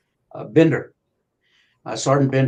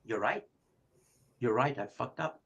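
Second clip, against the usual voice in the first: much softer, conceding; quiet admission; 11 dB quieter than usual. Retold or imitated. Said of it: "and he said".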